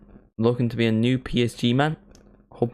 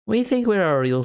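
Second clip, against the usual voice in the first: higher Northern Irish lilt; put-on Northern Irish accent; 9 semitones higher than usual.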